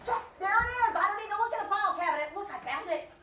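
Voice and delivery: eager relieved exclamation; relieved brain. Retold or imitated. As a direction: chirp it out like a found answer. Brain relieved at the name tag.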